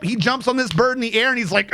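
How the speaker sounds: ordinary delivery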